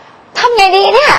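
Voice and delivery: high, slow, let-go cry of what now; emotional release. Shown as a demonstration. Teacher shows the student a high, slow emotional release. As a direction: pour it out, drawn out and high. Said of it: "เหมือนกับแบบปลดปล่อยไปแบบว่า".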